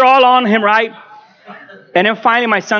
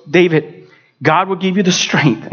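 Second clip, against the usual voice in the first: usual speaking voice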